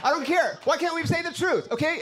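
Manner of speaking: normal talking voice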